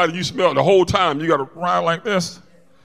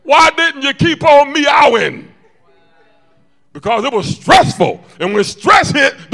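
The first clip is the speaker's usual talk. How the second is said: loud, high-pitched sermon shout; full-force preaching; 8 semitones higher, 11 dB louder than usual. Real, not acted. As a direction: drive the point home at full volume.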